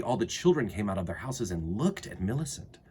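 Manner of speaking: plain reading voice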